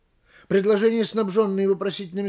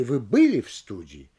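БЫЛИ jumps up, СТУДИИ falls low; question on БЫЛИ; 5 semitones lower than usual. Shown as a demonstration. Lecturer demonstrating a partial question, stress on БЫЛИ. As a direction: put the questioning peak on БЫЛИ only. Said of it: "вопросительную интонацию только в одном из них".

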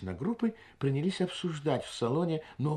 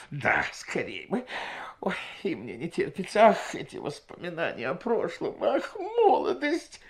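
higher, dreamy sighing woman's voice; wistful woman; 5 semitones higher than usual. A narrator's reading. Sister-in-law echoes the guest, dreamy and sighing. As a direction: sigh for lost youth.

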